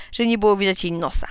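ordinary delivery, nothing marked

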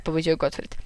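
ordinary delivery

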